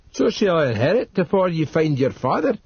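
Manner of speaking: put-on Northumbrian old servant. A narrator's reading. Narrator voices Dodd.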